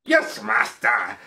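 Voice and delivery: put-on obedient henchman, drawn out; Igor. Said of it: "Igor's like".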